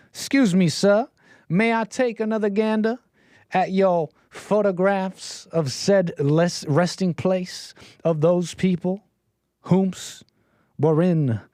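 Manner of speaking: prim Southern gent asking politely; Southern gentleman. Acted. Character asks Ryan for photos, mock Southern accent. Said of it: "my character's Southern in my mind".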